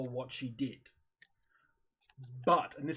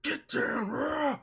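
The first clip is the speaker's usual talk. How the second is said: a raised, higher, louder snarl; mock yelling at the dog; 7 semitones higher, 4 dB louder, slower than usual. Owner yelling at her dog, mock raised yell. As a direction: imitate her snapping at the dog, half-hearted. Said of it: "yelling at it".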